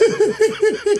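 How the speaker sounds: a burst of high laughter; real laugh. Real, not acted. Laughing at her own joke about her late father. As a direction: crack up at your own joke.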